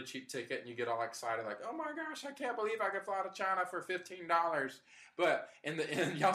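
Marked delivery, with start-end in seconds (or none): 1.6-4.8 mock excitement. Imitating a thrilled bargain buyer.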